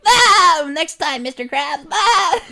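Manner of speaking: very high squeaky cartoon yelp; SpongeBob. Voicing SpongeBob's reply to Mister Krabs. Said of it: "SpongeBob's like".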